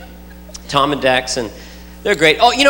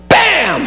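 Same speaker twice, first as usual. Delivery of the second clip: put-on toddler bam; 7 semitones higher than usual. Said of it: "just go".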